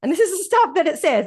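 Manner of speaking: ordinary delivery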